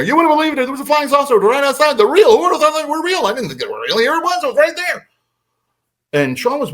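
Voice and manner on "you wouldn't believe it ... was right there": high, rapid-fire excited auctioneer; auctioneer babble. Retold or imitated. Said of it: "talking like an auctioneer".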